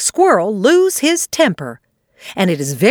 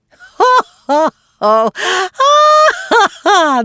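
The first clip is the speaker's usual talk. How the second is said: big high belly laugh; two friends laughing together; hearty laughter; 12 semitones higher, 4 dB louder than usual. A narrator's reading. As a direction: laugh out loud with him. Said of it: "he was laughing just as hard".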